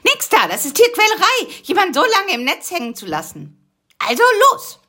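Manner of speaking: high, loud and bossy; high eager voice. Acted. Busy urging sleepy Karl-Heinz to move, high and loud. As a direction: push him along, squeaky and firm.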